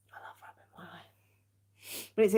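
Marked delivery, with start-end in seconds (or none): 0.1-1.0 barely audible unvoiced whisper; very soft, relieved whisper. Real, not acted. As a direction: whisper it to yourself while rubbing a tired eye. Relieved while rubbing tired eye.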